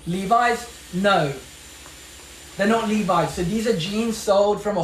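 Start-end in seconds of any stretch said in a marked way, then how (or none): none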